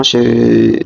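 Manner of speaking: ordinary talk, nothing marked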